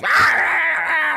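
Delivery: high, slow, loud mock jeering; mock crowd shout. MPs waving papers, mock shouted rah. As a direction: bellow like rowdy MPs. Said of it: "stand up with bits of paper".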